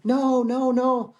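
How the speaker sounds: high pleading cries of no; retold screaming. Villagers rushing out at the car, crying out high and pleading.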